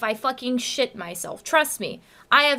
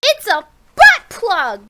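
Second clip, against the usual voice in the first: higher, louder mock outburst; loud exclamation; 6 semitones higher, 10 dB louder, slower than usual. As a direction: blurt it out, shocked.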